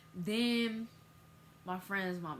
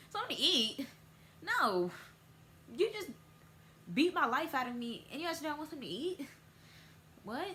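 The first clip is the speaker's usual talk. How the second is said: high, loud outraged disbelief; indignant outburst; 8 semitones higher, 4 dB louder, faster than usual. Retold or imitated. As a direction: rant at the parent. Indignant retort to parent.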